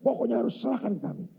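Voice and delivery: high-pitched, stubborn insistence; insistent demand. Retold or imitated. Tribesmen pressing the elder to hand over the boy, insistent demand. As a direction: imitate men refusing to take no. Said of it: "Ngotot orang-orang ini".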